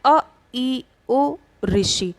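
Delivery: short vowels said slowly and clearly; short vowels sounded out. Shown as a demonstration. Teacher demonstrating short vowels to students, sounded out. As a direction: model each short vowel. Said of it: "जैसे अब आप बोल के देखिए".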